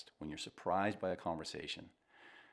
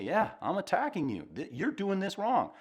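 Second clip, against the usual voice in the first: wife's retort; 10 semitones higher, 4 dB louder than usual. Retold or imitated. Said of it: "And she may say".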